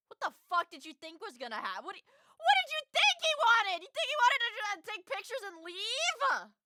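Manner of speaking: loud, high, slowed incredulous rant; incredulous yelling. Real, not acted. Streamer yelling at the girl's naivety, loud and incredulous. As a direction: yell it in disbelief, dragging the words out.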